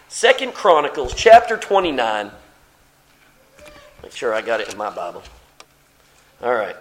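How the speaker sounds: usual speaking voice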